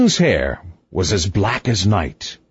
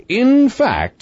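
high-pitched, emphatic summing up; triumphant conclusion; 13 semitones higher, 3 dB louder than usual. A narrator's reading. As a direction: conclude the argument as if you have won. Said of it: "tone of one who concludes an argument".

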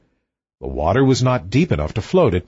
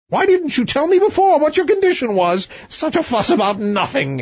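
high, impatient royal exclamation; the king brushes it off as a fuss; pitched-up exasperated king; 13 semitones higher than usual. Acted. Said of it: "exclaimed the king".

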